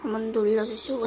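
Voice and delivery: ordinary talk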